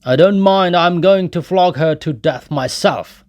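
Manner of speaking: put-on loud, high, drunken bellow; brutal shout. A narrator's reading. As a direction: roar it out, spiteful. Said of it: "shouted Mikolka".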